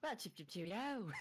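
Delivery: put-on high, slow, hammy British accent. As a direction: play it up like a posh Englishman. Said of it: "You are forever to speak in a British accent".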